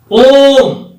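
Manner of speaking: ओम drawn out, held; long om. Shown as a demonstration. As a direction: sustain ओम to show a long vowel. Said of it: "और ज्यादा समय लगता है".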